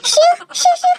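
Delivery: high, quick shoo noises; pretend shooing. Girl covering up before Dad, pretend shooing. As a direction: shoo an imaginary mosquito.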